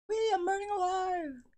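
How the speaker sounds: high singsong wail; burning scream. Pretend. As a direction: squeal it out gleefully. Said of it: "losing his mind".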